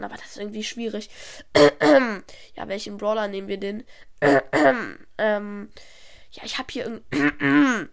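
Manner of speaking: keeps clearing the throat; constant throat clearing. Acted. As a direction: talk while clearing your throat. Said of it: "der sich ständig räuspert".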